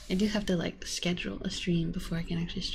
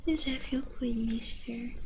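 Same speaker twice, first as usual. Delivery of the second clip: soft whispered cooing to the cat; ASMR whisper; 4 semitones higher, 4 dB quieter than usual. Real, not acted. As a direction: whisper sweetly to your pet. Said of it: "Have some kitty A S M R".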